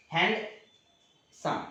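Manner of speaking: Handsome split in two, hand, long pause, सम; syllable-by-syllable demo. Shown as a demonstration. Teacher shows students how the word breaks in two, syllable by syllable. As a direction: say handsome slowly as two separate beats. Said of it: "दो बार में बोलाता है".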